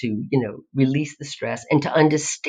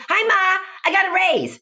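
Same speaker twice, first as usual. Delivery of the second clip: much higher, bright, bubbly hi Ma; excited good news. Retold or imitated. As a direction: burst in with happy news.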